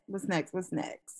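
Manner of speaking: ordinary talk, nothing marked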